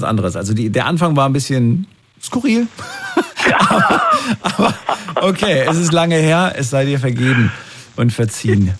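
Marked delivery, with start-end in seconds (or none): 2.7-3.5 a sudden high burst of laughter; high squealing laugh. Real, not acted. Radio host bursting into a high laugh at the caller's odd story. 3.6-6.5 words still bubbling with laughter; laughing. Real, not acted. Host forgiving the caller while still laughing.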